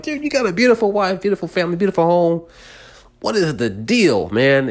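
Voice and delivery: high, fast exasperated "dude"; exasperated plea. Retold or imitated. Talking to the partying dad in his head, high exasperated plea. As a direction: plead with him in high, rushed disbelief.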